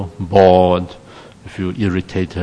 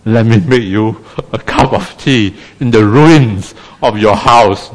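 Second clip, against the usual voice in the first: put-on a light English accent, slow and louder at the end; kindly English officer; 5 dB louder than usual. English police officer comforts a bombed-out woman in an English accent. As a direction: offer the tea in an English accent. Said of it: "this police officer said".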